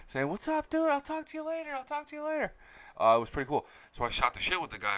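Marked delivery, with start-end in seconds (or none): 0.3-2.5 high mock yell at reduced volume; imitated yelling. Player yelling back to a fan, imitated yelling. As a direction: call it high, as if from far away. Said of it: "He starts yelling".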